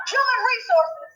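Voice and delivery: cracking into a tearful cry; real distress breaking through; upset mother nearly crying. Real, not acted. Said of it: "I am so upset".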